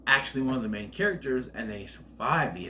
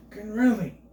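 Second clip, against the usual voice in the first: exasperated; 8 semitones higher, 5 dB louder than usual. Real, not acted. Real interruption, sighs in annoyance.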